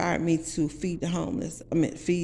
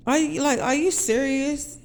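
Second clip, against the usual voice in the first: stunned disbelief; 10 semitones higher, 5 dB louder than usual. Retold or imitated. Stunned disbelief at his words.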